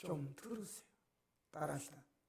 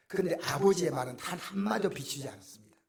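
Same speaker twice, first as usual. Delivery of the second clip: ordinary delivery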